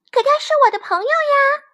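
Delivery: high, loud, quick little lamb voice; little lamb's high-pitched protest. A narrator's reading. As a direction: squeal an objection as a small lamb. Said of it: "小羊表示抗议".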